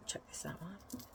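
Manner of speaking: conspiratorial whisper. Real, not acted. Conspiratorial aside to viewers.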